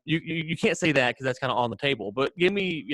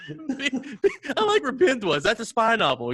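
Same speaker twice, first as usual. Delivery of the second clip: high, slow words squeezed out through laughter; real laughter, pitched much higher; laughing; 10 semitones higher, slower than usual. Real, not acted. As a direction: let the laugh ride over every word. Still laughing at the joke.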